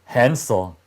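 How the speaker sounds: usual speaking voice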